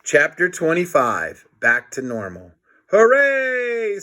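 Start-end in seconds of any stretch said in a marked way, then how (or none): 2.9-4.0 high, loud hooray; cheer. Acted. Harold celebrates everyone being normal, high gleeful cheer. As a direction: cheer with glee.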